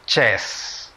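"Chess" whispered in English, clear ch sound; whispered English word. Shown as a demonstration. Chess teacher models the English word in a whisper. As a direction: breathe out "Chess" unvoiced, in English. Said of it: "cómo se dice ajedrez en inglés".

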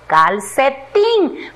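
"calcetín" slow, in three parts: cal, ce, tín; separado en sílabas. Shown as a demonstration. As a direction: stretch and separate each syllable of "calcetín". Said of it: "luego vamos a separar en sílabas". ("calcetín" despacio, sílaba por sílaba)

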